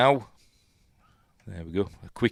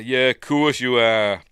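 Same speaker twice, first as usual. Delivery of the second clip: humouring disbelief; 4 semitones higher, 8 dB louder than usual. Retold or imitated. Imagined sceptical reply to spaceship claim.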